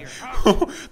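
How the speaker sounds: high breathy laugh. Real, not acted. Gamer laughing at a weird character face. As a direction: burst out laughing.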